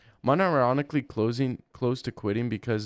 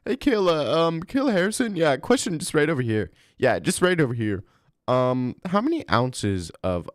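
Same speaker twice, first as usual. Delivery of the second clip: high mock interviewer, a bit louder; calling out from the press row in a raised silly voice; mock reporter; 6 semitones higher, 4 dB louder than usual. Mock press-conference question again.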